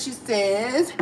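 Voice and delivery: ordinary talk, nothing marked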